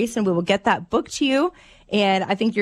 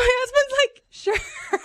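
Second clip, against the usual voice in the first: breaking into laughter mid-line; laughing; much slower than usual. Real, not acted. Mom cracking up telling the hosts her husband's answer. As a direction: voice cracking with giggles, high.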